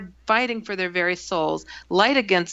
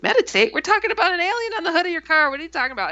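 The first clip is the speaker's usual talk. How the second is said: high, fast retold outburst; incredulous; 10 semitones higher, much faster than usual. Narrator reacts to roommate wanting to meditate, incredulous. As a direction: rattle off an exasperated protest, pitched up. Acted disbelief at roommate's words.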